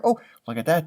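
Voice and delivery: ordinary delivery, nothing marked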